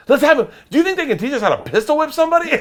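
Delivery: high, loud, quick goofy pitch; giddy excitement. Retold or imitated. Tipsy friend plotting with bodyguards, giddy excitement. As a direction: rattle off a silly idea excitedly.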